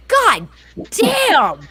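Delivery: "god damn" split by a pause, "damn" high; exasperated emphasis. Retold or imitated. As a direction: break before "damn" and hit it hard. Exasperated at her son.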